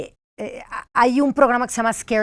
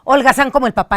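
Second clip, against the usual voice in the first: ordinary delivery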